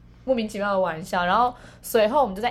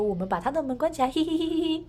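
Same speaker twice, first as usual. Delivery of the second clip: playful mocking tone with a sly giggle, pitched up; teasing; 8 semitones higher than usual. Friends mocking the sleeping narrator, sly teasing giggle. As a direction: tease like a prankster shutting the door. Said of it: "用很戏谑的语气说".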